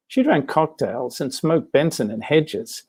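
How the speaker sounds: usual speaking voice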